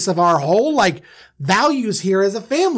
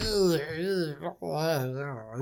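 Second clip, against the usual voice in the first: pained groans; 3 semitones lower, 11 dB quieter, much slower than usual. Retold or imitated. Joseph beaten in the pit.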